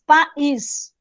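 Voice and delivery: "país" repeated in two detached pieces, pa and ís; syllables separated. Shown as a demonstration. Teacher repeating the hiatus example for students, syllables separated. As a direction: leave a gap between pa and ís in "país". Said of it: "São vogais em sílabas diferentes".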